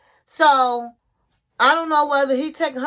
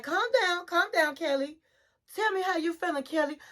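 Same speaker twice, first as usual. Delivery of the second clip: softer, lightly acted soothing voice; calming; 4 semitones higher, 7 dB quieter than usual. Therapist settling an upset client, softly calming. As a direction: imitate someone gently settling down an argument.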